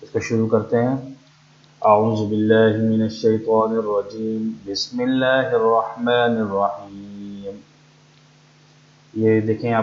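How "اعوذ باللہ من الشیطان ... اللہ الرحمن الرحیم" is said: slow, lower chanted recitation of the invocation; Quranic recitation. Acted. Teacher opening the class with a slow recited invocation. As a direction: intone it slowly like tilawat before the lesson.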